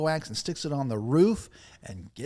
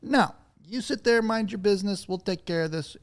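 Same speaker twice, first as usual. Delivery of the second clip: quoting the crowd; 7 semitones higher than usual. Retold or imitated. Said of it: "did they say".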